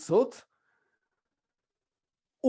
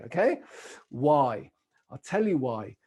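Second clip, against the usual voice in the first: ordinary talk, nothing marked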